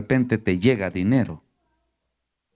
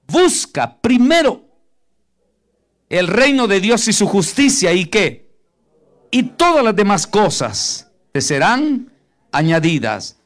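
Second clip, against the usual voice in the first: fervent preaching; 6 semitones higher, 5 dB louder than usual. Acted. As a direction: proclaim each phrase to the congregation. Preaching the verse at full force.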